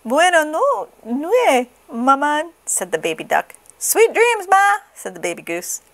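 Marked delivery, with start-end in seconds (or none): 0.1-2.5 put-on high baby goodnight; high fumbling baby duck. 3.8-4.8 put-on very high baby voice; very high baby goose goodnight.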